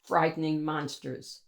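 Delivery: ordinary delivery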